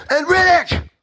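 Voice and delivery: high, drawn-out announcer call; triumphant name reveal. Acted. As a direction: cry out the last name as the big climax. Climax of his list of names.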